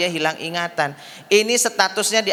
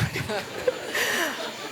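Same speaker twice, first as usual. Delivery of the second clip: high breathy giggle; high laugh; 11 semitones higher, 9 dB quieter than usual. Real, not acted. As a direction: burst out laughing at the joke. Joke punchline laugh.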